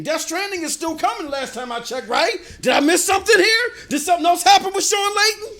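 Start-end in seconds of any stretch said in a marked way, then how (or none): 2.6-5.6 loud, high mock-puzzled questions; mock disbelief. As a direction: ask it loudly, feigning total bafflement. Mock questions as a cheap shot.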